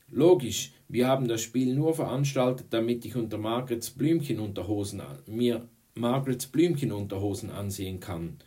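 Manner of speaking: loud, high opening, heavily sarcastic; exasperated sarcasm. A narrator's reading. Boy mocks the girls' accusation, exasperated sarcasm. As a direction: throw the absurd charge back at them. Boy's sarcastic retort, hands raised.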